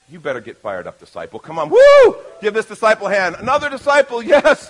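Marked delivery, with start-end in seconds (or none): 1.7-2.2 loud high cheering whoop. Real, not acted. Pastor cheering a volunteer on stage, loud whoop. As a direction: let out a big excited woo.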